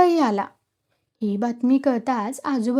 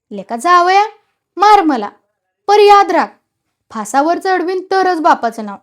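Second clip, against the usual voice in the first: high, loud, blustering challenge; emboldened threat; 4 semitones higher, 7 dB louder than usual. A narrator's reading. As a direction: dare him loudly, then warn him. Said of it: "संपतरावाला जोर चढला".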